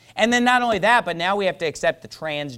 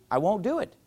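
usual speaking voice

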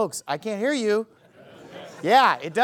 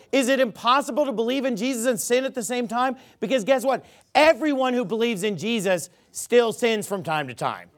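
normal talking voice

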